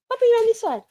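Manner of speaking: dog-talk voice. Real, not acted. Talking to the dog.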